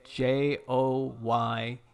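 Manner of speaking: slow letter-by-letter spelling. Real, not acted. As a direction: give each letter its own space. Spelling out the link's name.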